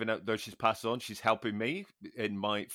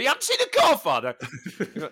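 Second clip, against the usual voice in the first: incredulous; 4 semitones higher, 4 dB louder than usual. Retold or imitated. Mock disbelief at friend's admission.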